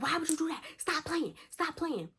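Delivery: high-pitched mock distressed scolding; imitated upset pleading. Friend scolds driver for frightening them.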